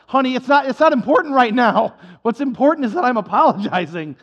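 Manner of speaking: insistent husband. Acted. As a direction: bluster past the question.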